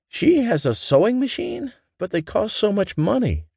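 high, astonished motherly voice; mother amazed at the cost of a sewing machine; surprised mother. A narrator's reading. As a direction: gasp it out in wonder.

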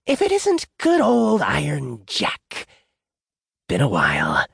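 theatrical trickster sneer; sneering jackal. A narrator's reading. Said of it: "the jackal sneered".

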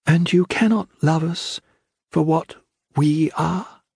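high voice, slow and steady question; steadied, slow plea from the daughter. Acted. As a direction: ask it slowly, holding steady. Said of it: "words slow, steady".

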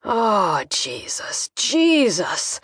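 distressed father's sighed oath, rising at the end; overwhelmed dad, breath giving out then surging; ragged sigh. A narrator's reading. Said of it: "I felt his ragged sigh".

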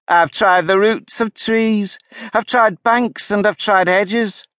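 high, slow, aggrieved bird voice; fretful pigeon. A narrator's reading. Weary pigeon complains to Alice about serpents.

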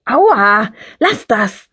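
loud yelp, then protest; hurt, annoyed squeal; pained protest. A narrator's reading. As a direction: cry out as your hair is pulled. Hair pulled in a fight.

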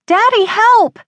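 put-on high, loud child's cry for help; desperate cry. A narrator's reading. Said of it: "Ben cried out".

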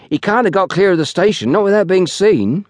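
put-on high-pitched rustic young porter. A narrator's reading. Young porter reasons with the station master, confident. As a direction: argue it in a lad's high voice.

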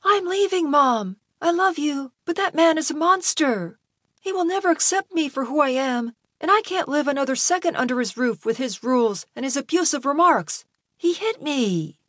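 high, upset voice of Malcolm; wounded son standing firm, pitched well up; hurt, resolute son. A narrator's reading. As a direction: tell your mother you've had enough.

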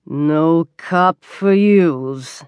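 very slow, thick waiter voice; heavy, dragged-out refusal from a waiter; waiter's butter-cake voice. A narrator's reading. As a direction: draw it out like a stodgy, unimpressed waiter. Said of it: "with a voice like butter cakes".

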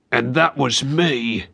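put-on slow, drawn-out menacing teen; different voice. A narrator's reading. Unseen teenage boy taunting the children, slow and menacing. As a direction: toy with them, slowly and coldly. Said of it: "a different voice".

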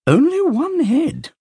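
very high, slow, drawn-out mockery; a falsetto taunt; high mock sing-song. A narrator's reading. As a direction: lift it way up and linger on it. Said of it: "said Peter".

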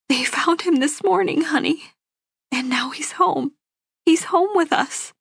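high, tearful joy; happy cry. A narrator's reading. Mother says he's home with them, happy tearful. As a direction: choke up with happiness, pitched well up. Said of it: "This is a happy cry".

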